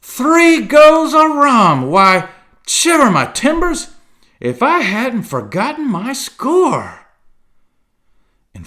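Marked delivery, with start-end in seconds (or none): 0.0-7.1 roaring outburst. A narrator's reading. Silver remembers his rum bill.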